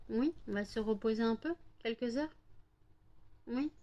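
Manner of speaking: soft, tender voice for the dog. Real, not acted. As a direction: coo her gently to sleep. Soothing dog to sleep. (voix douce de bébé pour le chien)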